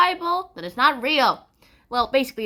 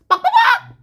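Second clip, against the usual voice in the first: very high, loud mock clucking; chicken squawk; 9 dB louder than usual. Narrator voicing the chickens aboard, loud squawk.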